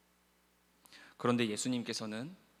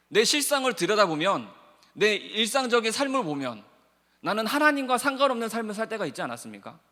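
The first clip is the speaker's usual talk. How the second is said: louder, higher impassioned sermon delivery; fervent preaching; 9 semitones higher, 11 dB louder than usual. Acted. Pastor urging the congregation about failing God, impassioned preaching. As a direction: press the congregation with rising fervor.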